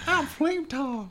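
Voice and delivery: very high, slow squeaky character voice; Flame Tart. Pretend. Player trying out a character, tiny high squeak. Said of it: "didn't Flame Tart have kind of".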